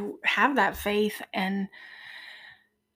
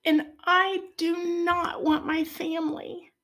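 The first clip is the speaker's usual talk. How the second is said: real tearful, higher-pitched voice; choked up, voice lifting high with feeling; emotional; 6 semitones higher than usual. Real, not acted. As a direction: fight back tears while pressing on. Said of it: "sorry, I get emotional".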